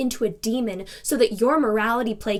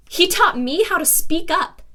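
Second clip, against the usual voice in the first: raised, higher, emphatic defence; heated defiance; 6 semitones higher, 7 dB louder than usual. Acted. Woman defending her mentor to a reporter, loud and defiant. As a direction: speak up forcefully for your mentor.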